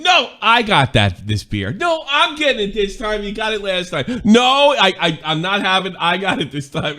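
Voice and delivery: high-pitched, exaggerated polite insistence; mock overpolite squabble. Pals arguing over who pays for the beer, mock overpolite. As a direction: insist on paying in a hammed-up high voice. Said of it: "unbelievably polite to each other".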